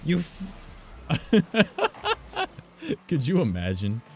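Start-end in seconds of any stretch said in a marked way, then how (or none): 0.6-3.0 sudden squealing laugh, very high; high burst of laughter. Real, not acted. Gamer cracking up mid-curse at his teammate. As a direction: break into a high, loud laugh.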